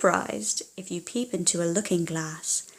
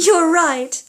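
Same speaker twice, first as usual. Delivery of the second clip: higher, louder laugh into the words; delighted laugh; 8 semitones higher, 6 dB louder than usual. Acted. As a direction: laugh and agree with joy.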